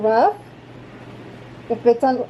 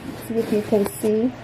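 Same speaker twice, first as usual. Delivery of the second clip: speaking behind a face mask; muffled through a mask; 11 dB quieter than usual. Real, not acted. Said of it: "it's because I have my mask on".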